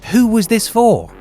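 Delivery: ordinary talk, nothing marked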